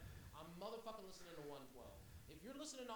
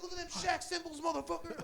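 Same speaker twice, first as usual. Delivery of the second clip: much louder and higher, taunting; mocking yell; 12 semitones higher, 16 dB louder than usual. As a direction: rub his face in it. Mocking the fake bills.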